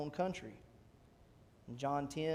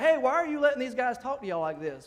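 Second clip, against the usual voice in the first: higher, louder protest; indignant challenge; 7 semitones higher, 7 dB louder than usual. Acted. David comes down to the battlefield and challenges the soldiers, indignant. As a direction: call them out in disbelief.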